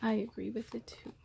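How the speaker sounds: quiet aside. Real, not acted. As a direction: drop the voice and say it to yourself. Reader's own aside after long pause.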